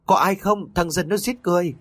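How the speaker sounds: panicked call for help; old man shouting for help. Acted. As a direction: yell it out, desperate to be heard. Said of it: "lão có hô to".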